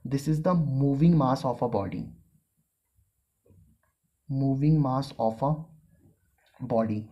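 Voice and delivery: very slow, one term at a time; teacher dictating the formula. Shown as a demonstration. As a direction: spell the equation out for note-taking. Dictating a definition.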